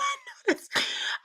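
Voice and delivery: shrill wheezing cackle; helpless high laughter; high squealing laugh. Real, not acted. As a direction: lose it completely. Cracking up.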